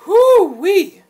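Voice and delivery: high, loud whoop; excited whoop. Real, not acted. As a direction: yelp at the strong smell. Reacting to strong smell.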